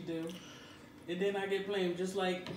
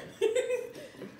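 high squealing laugh; 4 dB louder than usual. Real, not acted. Laughing at the joke.

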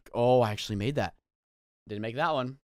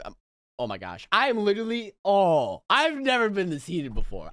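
usual speaking voice

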